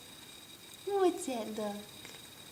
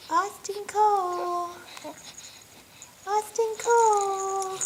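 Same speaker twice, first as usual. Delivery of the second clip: calling his name; 4 semitones higher, 7 dB louder than usual. Real, not acted. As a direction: call him out brightly and long.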